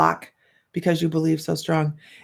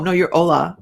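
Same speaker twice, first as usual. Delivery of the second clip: usual speaking voice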